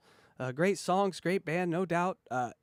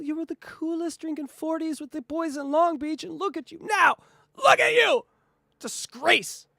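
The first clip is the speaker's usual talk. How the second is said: rising to a high mocking shout; mock scolding; 7 semitones higher than usual. Hosts mock a pop star for selling out, jeering rising to a shout. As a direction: scold her like a let-down old fan. Mocking [name] with her fans.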